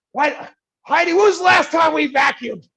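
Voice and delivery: put-on high, loud, fed-up shout at the kids' mess; exasperated yell. Parent stepping on a Lego yells at Heidi about vacuuming, exasperated shout. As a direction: blow up at Heidi over the Legos. Ranting about Legos on stairs.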